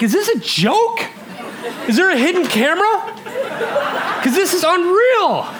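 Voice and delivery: high-pitched exasperated disbelief, acted out; losing it, incredulous. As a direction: demand answers as if at wit's end. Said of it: "I'm losing it".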